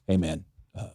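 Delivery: sorry under your breath, ashamed; sheepish apology. Retold or imitated.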